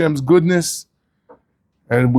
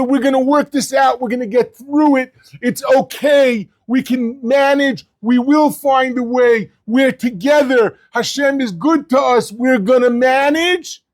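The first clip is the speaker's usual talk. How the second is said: encouraging family; 7 semitones higher than usual. Retold or imitated. Families voiced encouraging each other.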